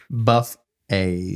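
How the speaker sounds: "Buff-a" split into BUFF and AY; "Buff-a" broken after the F, mockingly; mock two syllables. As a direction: mimic "Buff-a" as two separate words. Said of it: "like it was two syllables".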